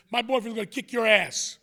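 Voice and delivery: loud, punchy mock threat; imitated taunt.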